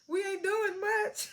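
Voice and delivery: high, slow and laughing through it; laughing replay. Retold or imitated. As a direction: drag the line out, cracking up. Replaying Maisha's line, laughing.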